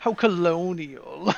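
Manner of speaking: slow mock-British accent, laughing. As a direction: draw it out in a teasing British accent. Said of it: "keep doing that accent".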